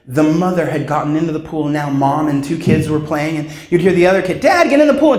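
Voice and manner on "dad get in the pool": put-on child's voice. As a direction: call to dad like a small child begging. Child again.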